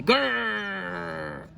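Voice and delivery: long rolled grrr, fading off; tiger growl. Acted. Tigers answering the boy, long wordless growl. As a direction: answer with a sustained animal growl. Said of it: "so they could only say".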